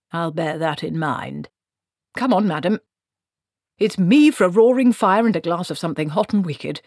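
put-upon wizard. Acted. Wizard urging Granny towards a warm fire, pleading. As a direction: urge her like a weary-of-it wizard. Wizard figure wants to go home.

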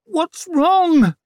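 very high, loud, drawn-out demand; pained demand. A narrator's reading. Mother in agony demanding answers from surgeon, strained demand. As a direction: wail the demand through gritted teeth. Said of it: "hissed Savine through her gritted teeth".

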